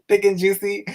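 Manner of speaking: tickled by the viewer's cheeky comment; amused and excited. Real, not acted.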